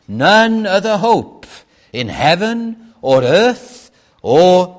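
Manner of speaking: loud preaching voice; fervent preaching. Acted. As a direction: preach it loudly to the congregation. Preaches at full force.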